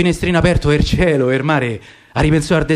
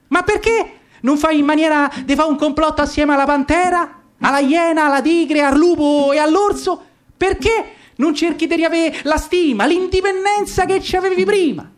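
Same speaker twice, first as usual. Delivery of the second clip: higher, chattering monkey voice; 7 semitones higher than usual. Acted. Monkey urging the caged lion to rebel, higher voice. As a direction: pitch it up like a cheeky monkey. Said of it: "Una scimmia ... gli disse".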